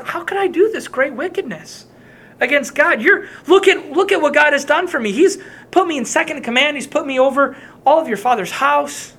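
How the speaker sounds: high, urgent protest; indignant refusal. Acted. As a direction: voice Joseph's shocked refusal. Joseph refusing Potiphar's wife.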